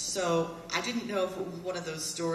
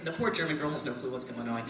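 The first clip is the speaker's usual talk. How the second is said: ordinary talk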